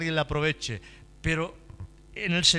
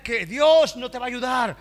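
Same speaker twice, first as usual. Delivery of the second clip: loud, high rolling litany; impassioned preaching; 6 semitones higher, 6 dB louder than usual. Acted. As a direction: pile up the devil's lies insistently. Preacher voices the devil's lies.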